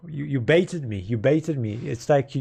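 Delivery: ordinary talk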